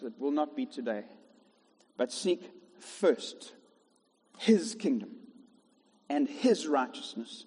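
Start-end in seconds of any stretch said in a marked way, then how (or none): 2.0-7.5 slow, stressed "seek first"; drawn-out scripture, weight on "seek first"; slow emphatic reading. Shown as a demonstration. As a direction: lean on "seek" and "his", slowly. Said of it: "This can be so lost on us".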